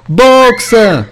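very high, loud anguished cry; terrible scream. A narrator's reading. Clover screaming to Boxer at the truck window, anguished. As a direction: scream his name in horror. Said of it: "țipă Clover cu o voce teribilă".

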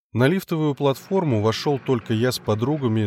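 plain reading voice

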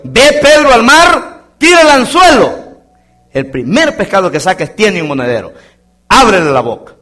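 high, loud ringing command; Jesus commanding. Acted. Jesus sends Peter to fish in a loud, ringing command. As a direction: call it out like God's order.

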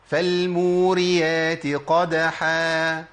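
فالموريات قدحا drawn out very slowly, with a clear qaf in قدحا; measured recitation showing the qaf in قدحا; slow Quran recitation. Shown as a demonstration. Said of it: "دي بالقاف".

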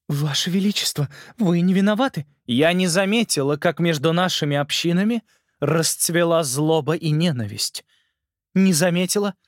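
lightly voiced uncertain queen; a queen admitting fault, unsure; hesitant queen voice. Ant Queen speaks.

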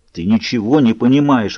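ordinary delivery, nothing marked

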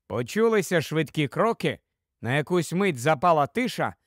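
ordinary delivery

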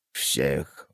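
slow, low, soft creaking voice; creaky creature voice. Acted. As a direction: creak the question out slowly. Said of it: "проскрипел Зубан".

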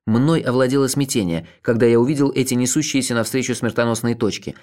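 ordinary delivery, nothing marked

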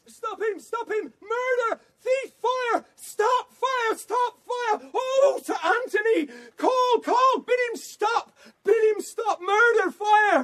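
high, breathless calls for help; frantic alarm cries. Acted. Servant crying out for someone to stop the captain, high frantic calls. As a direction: cry out after the fleeing man, panicked.

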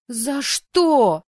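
high, loud outraged wail; full-voiced indignant yell. A narrator's reading. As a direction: cry out in protest. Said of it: "заорала я".

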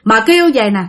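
usual speaking voice

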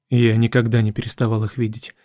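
ordinary delivery, nothing marked

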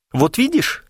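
high-pitched female voice; woman's voice. A narrator's reading. Woman scolds Клинков from behind his back, female voice. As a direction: play the woman, pitched well up. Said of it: "сказал женский голос".

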